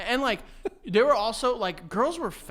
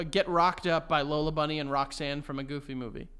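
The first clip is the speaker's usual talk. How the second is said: ordinary delivery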